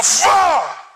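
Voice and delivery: high shouted whoosh of the drop; whoosh cry. Retold or imitated. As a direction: yell as the car plunges. Ride drops suddenly.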